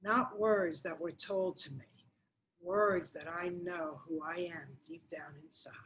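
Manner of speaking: soft sincere whisper. Real, not acted. Coach urging viewers toward self-worth, sincere whisper. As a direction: whisper it like a secret truth. Intimate prompt to look inward.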